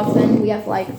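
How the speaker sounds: ordinary talk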